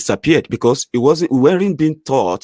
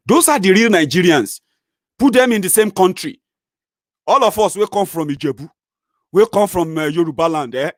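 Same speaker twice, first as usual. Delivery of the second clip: high, loud, rising passion; fired up; 8 semitones higher, 4 dB louder than usual. Real, not acted. Praising multilingual Nigerians, high and loud passion. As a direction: preach it with fire.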